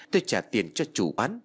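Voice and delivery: usual speaking voice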